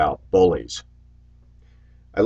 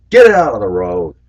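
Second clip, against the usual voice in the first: firm, raised call out of the window; 7 dB louder than usual. Retold or imitated. Bus driver to kid in road, firm raised call.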